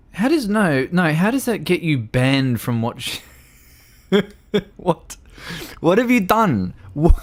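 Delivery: high, incredulous, breaking into laughter; amused disbelief. Real, not acted. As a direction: demand an explanation while cracking up. Baffled at the ban, laughing.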